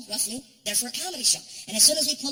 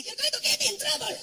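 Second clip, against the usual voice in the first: high giggly reply; a girl laughing behind her veil; laughing woman; 12 semitones higher than usual.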